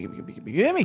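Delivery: usual speaking voice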